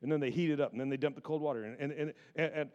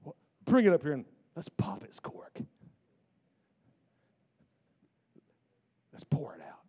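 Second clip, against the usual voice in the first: God whispering to the Holy Spirit, hushed. Acted. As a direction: whisper it like a secret plan. God voiced telling Spirit privately.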